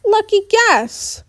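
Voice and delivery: high, slow, spiteful retort; spat out. A narrator's reading. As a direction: spit the words out, stung and defensive. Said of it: "Shapri spits".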